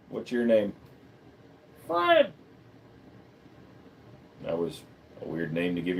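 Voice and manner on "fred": very high squeaky put-on voice; silly character voice. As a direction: answer as a tiny, squeaky character. Answers own question as silly character.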